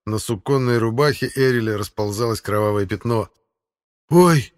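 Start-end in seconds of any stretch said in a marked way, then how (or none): 4.1-4.5 high, loud cry of shock; startled yelp. A narrator's reading. Young warrior sees wounded comrade, startled yelp. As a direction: blurt it out, taken aback. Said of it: "только и мог сказать Ивар".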